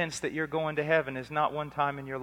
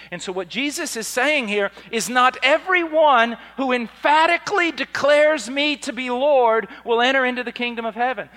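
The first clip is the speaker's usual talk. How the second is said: raised, loud sermon declaration; emphatic preaching; 9 semitones higher, 12 dB louder than usual. Real, not acted. Preacher explaining Jesus' words to the congregation, loud and emphatic. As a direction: drive the point home from the pulpit.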